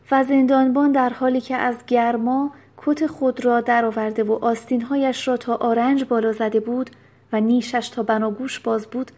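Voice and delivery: usual speaking voice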